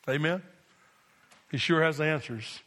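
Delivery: usual speaking voice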